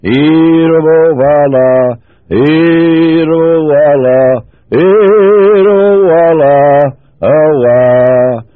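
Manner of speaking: slow, drawn-out chanted tongues, rising on Irowala; speaking in tongues. Retold or imitated. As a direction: intone each word slowly like a heavenly language. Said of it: "interpreted into English".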